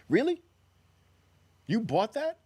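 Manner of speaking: mocking disbelief at a silly idea; high, loud, scoffing Really; incredulous. Real, not acted. Incredulous reply to naive trader.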